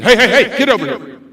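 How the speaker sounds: loud, sharp call; urgent attention-getter. Shown as a demonstration. Adult to student in danger, urgent loud call. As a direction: summon them at once. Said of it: "you need to get their attention".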